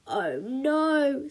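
groaning, drawn-out "no"; teenager's mortified sigh; sighed dismay. A narrator's reading. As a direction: sink into embarrassed despair. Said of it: "sighed Punk".

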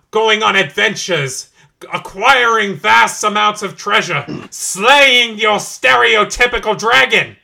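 building from steady to loud, high rant; rising rant. Acted. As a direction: work up into a villain tirade.

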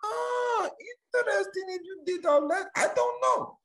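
very high, squeaky put-on voice; mock falsetto voice. Comic mimicking a FaceTime caller, silly falsetto.

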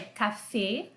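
"café" with a closed "ê" at the end; closed French E. Shown as a demonstration. Teacher showing acute accent isn't open like Portuguese, closed "café". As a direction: say "café" ending in a tight, closed E. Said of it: "não é aberto igual em português".

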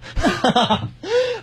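high-pitched burst of giggling; high giggle. Real, not acted. Guest giggles at the useless scolding, high giggle. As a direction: giggle high at his own story. Laughs at own story.

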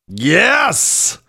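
usual speaking voice

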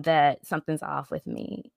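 ordinary delivery

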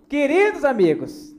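"Queridos amigos" slow, high and loud; "Queridos amigos" enunciated grandly like a show host; showy diction. Shown as a demonstration. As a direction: proclaim "Queridos amigos" with drawn-out, careful diction. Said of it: "a dicção tá ótima hoje".

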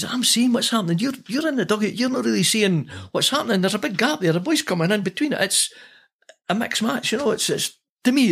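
high, pushed retort to the manager; heated argument. Retold or imitated. Player protesting to his manager in a high, heated voice. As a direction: argue your case hard, voice up high.

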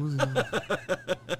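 slow, laughing question. Real, not acted. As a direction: giggle through the question. Laughing confusion.

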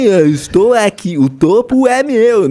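voz aguda e arrastada de maluco; entonação de maluco. Shown as a demonstration. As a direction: leia devagar e bem agudo, feito doido. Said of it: "mostrava uma entonação de maluco". (entonação maluca, alta e esticada)

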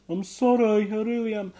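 high little goblin voice saying sorry; goblin apology. A narrator's reading. Ugnok apologising to mum, high goblin voice. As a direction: apologise like a told-off child. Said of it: "Ugnok was like".

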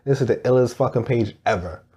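usual speaking voice

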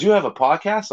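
surprised question. Retold or imitated. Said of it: "and he goes".

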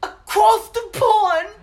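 loud, high, slow mock British; hammed-up British cry, drawn out; mock British accent. Hosts joke about Britain, hammed-up loud British accent.

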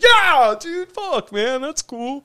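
very high, loud excited gush; gushing drunk. Retold or imitated. Drunk guy gushing to the young cop about his truck, very high. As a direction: gush like you just met an old pal. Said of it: "And I was like".

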